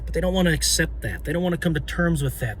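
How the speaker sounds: quick, matter-of-fact delivery; fast analytical tone. Real, not acted. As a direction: rattle it off like an analyst. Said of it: "be analytical for a minute".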